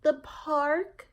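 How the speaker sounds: put-on high, slow child's voice; a small girl explaining herself; little girl voice. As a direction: play young Jamaica answering her mother. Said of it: "Jamaica said".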